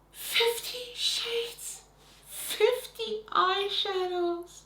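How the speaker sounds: high, slow, drawn-out disbelief; incredulous. Real, not acted. Reviewer reacting to a fifty-shadow palette, high stretched disbelief.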